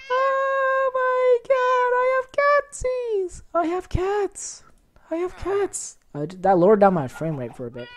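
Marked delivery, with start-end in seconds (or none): 0.1-6.0 very high, slow, squealing delight. Real, not acted. Cats spawn from lucky block.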